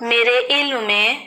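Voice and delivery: usual speaking voice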